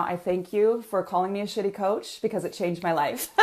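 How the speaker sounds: usual speaking voice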